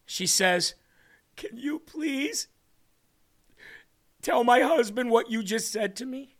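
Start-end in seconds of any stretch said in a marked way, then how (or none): 1.4-6.3 higher-pitched pleading, retelling a crying woman; tearful plea. Retold or imitated. Woman asks him to tell her husband, tearful plea. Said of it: "she's crying".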